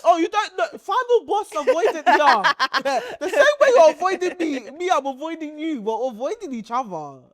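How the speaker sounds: ordinary talk, nothing marked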